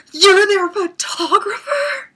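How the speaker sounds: high, very loud, drawn-out wail of disbelief; inner fangirl scream. Retold or imitated. Fan screaming inside about meeting their photographer, starstruck. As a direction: shriek it slowly like a starstruck fan. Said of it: "and inside I'm going".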